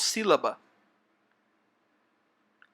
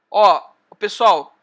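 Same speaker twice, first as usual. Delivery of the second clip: loud, slow call for attention; calling the class; 9 dB louder, slower than usual. Real, not acted. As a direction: raise the voice to get the room's attention. Chamando a atenção da turma. (chamando a turma em voz alta e devagar)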